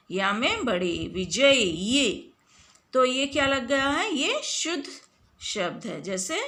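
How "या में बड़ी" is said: ordinary talk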